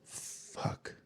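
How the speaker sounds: breathy whispered fuck into his hands; a voiceless despairing whisper; whispered curse. Retold or imitated. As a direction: whisper it, head in hands, defeated. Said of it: "and I was like".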